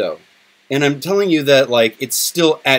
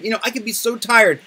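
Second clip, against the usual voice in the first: usual speaking voice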